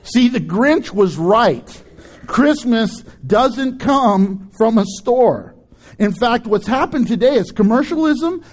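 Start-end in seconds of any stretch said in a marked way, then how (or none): 0.0-5.6 slow emphatic. Real, not acted. Slow emphatic sermon point.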